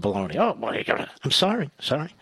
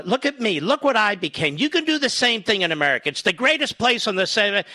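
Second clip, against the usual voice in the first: mock inspiring role model; 9 semitones higher than usual. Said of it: "and say to other, uh, little girls of color".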